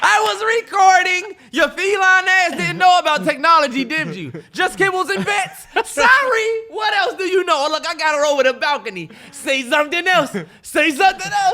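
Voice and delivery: high, loud mocking gloat; loud gloat at the cat. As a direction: lord it over the cat. Gloating at cat over balcony.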